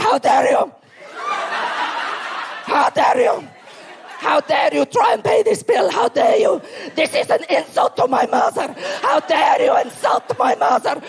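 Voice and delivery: hammed-up furious uncle; uncle's mock outrage. Comic uncle shouting at her dad over the bill, hammed-up rage. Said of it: "he'll be like".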